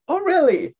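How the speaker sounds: imitated surprise leaping up high; impressed customer. Customer hearing the clerk's recommendation, impressed surprise. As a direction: swoop up, loud, on "really".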